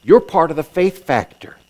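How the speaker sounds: ordinary delivery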